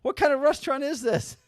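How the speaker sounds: high, outraged disbelief; mock-furious buddy venting; pissed-off friend. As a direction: yell it like you can't believe this place. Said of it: "so my buddy Mark was pissed".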